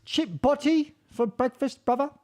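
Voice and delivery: a cheeky Londoner ordering chip butty; overdone British accent with a rising "bruv"; mock British accent. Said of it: "Maybe it's bruv".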